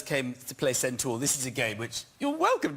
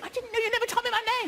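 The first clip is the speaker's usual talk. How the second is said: fast, much higher mock child whine, laughing through it; kid's protest; faster than usual. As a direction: play the kid blurting out his excuse. Kid protesting in home movie.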